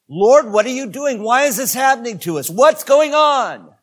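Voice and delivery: voiced high, pleading and loud; a congregation's bewildered cry to heaven; desperate plea. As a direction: cry out to God in confusion. Imagined desperate cry to God.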